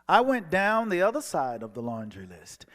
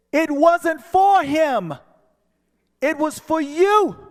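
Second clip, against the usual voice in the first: higher, louder, slow spirit voice; ethereal voice; 13 semitones higher, 12 dB louder, slower than usual. Retold or imitated. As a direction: speak as a joyful heavenly voice. Ethereal voice speaks.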